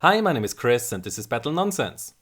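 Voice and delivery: normal talking voice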